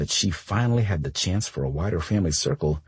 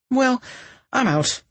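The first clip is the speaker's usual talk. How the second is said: cheery goodbye. Acted. Cora cheerfully leaving.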